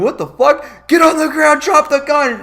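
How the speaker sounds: hammed-up police shouting; mock cop shout. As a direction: yell it like a cop at gunpoint. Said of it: "they're like, they're like".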